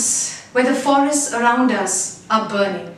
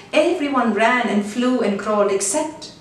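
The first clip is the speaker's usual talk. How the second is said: usual speaking voice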